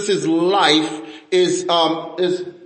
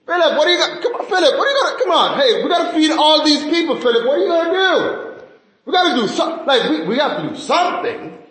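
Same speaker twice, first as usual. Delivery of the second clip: high, teasing pestering; mock-urgent Jesus; 8 semitones higher, faster than usual. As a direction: badger someone in playful pretend alarm, voice pitched up. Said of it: "He was pulling his leg".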